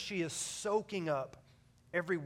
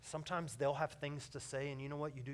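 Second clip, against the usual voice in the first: ordinary talk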